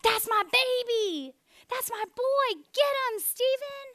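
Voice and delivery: mock stadium cheer for a son; imitating a cheering fan. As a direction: shout like a parent in the stands. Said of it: "He was saying".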